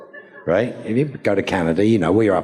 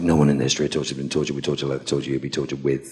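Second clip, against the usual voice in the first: one breathless rush, very fast; fast stage villain rattling off the threat; rattled off fast; 4 semitones lower, 3 dB quieter, much faster than usual. Retold or imitated. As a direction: race through the tongue-twister at top speed. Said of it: "and I'm like".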